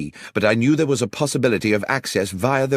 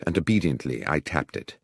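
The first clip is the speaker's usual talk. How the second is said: ordinary delivery, nothing marked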